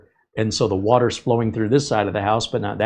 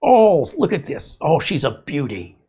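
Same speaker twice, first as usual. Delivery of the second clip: Steve Irwin; 5 semitones higher than usual. Retold or imitated. Showing how the drain man sounds, admiring mock Australian accent. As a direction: marvel in an imitated Aussie croc hunter accent. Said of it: "he sounds like Steve Irwin".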